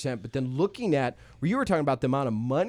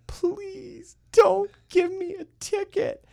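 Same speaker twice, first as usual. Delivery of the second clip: much higher, slow hammed-up wail; mock crying plea; 4 dB louder, much slower than usual. Teen begging the cop not to ticket him, mock sobbing. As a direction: beg like a sobbing kid, overdone. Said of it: "like, crying".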